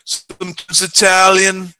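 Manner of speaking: high, slow put-on voice for the gangster; a camp gangster line, slow and high. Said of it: "he's like".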